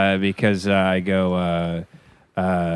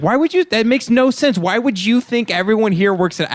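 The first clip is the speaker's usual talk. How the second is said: high, incredulous rant; exasperated, high-pitched mock tirade; retold mounting anger; 9 semitones higher than usual. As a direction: re-enact going in on someone. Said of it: "I started getting really mad".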